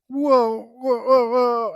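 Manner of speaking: hammed-up rising panic; mock panic. Drunk guy losing balance on the kayak, frantic mock panic.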